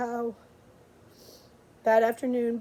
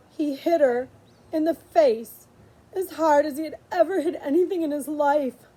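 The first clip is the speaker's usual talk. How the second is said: plain reading voice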